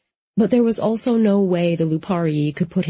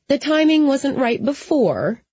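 higher-pitched woman cutting in; agreeing firmly; 8 semitones higher than usual. A narrator's reading. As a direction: concede the point in a high, emphatic voice. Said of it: "she agreed".

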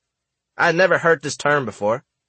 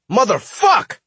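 frustrated howl; 8 semitones higher, slower than usual. Retold or imitated. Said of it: "he howled in complete frustration".

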